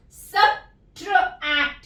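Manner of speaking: Subtract drawn out slowly and clearly; slow model word. Shown as a demonstration. Teacher models subtract slowly, then normally. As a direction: stretch subtract for the class to copy. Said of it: "तो हम इसको कैसे पढ़ेंगे".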